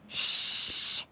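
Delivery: soft unvoiced shush; whispered shush. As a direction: hush someone, breath only. Hushing the little sister.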